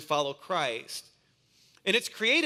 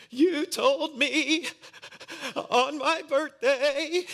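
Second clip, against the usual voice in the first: put-on crying little boy; 10 semitones higher, 4 dB louder, much slower than usual. Preacher voices crying son.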